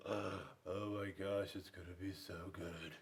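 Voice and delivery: soft, low, dreamy bear thinking aloud; Bruce's dreamy thought. Acted. Grumpy bear dreaming of his recipe, low and softly. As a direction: savour the thought like a hungry bear. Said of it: "Bruce thought to himself".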